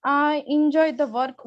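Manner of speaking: usual speaking voice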